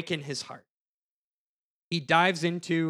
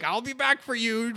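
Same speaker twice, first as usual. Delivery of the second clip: Scooby-Doo villain; 8 semitones higher than usual. Retold or imitated. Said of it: "Scooby-Doo villains his way out".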